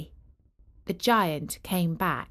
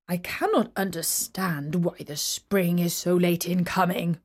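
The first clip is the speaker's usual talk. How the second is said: puzzled giant; 3 semitones lower than usual. Acted. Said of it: "said the selfish giant".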